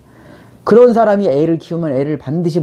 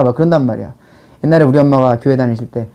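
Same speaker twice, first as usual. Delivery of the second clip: ordinary talk, nothing marked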